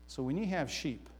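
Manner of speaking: ordinary delivery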